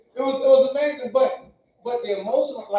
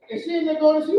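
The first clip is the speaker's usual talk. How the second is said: ordinary delivery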